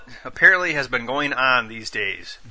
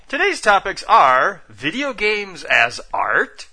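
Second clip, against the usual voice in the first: announcer voice; 6 semitones higher, much slower than usual. Pretend. Announcer-style topic intro.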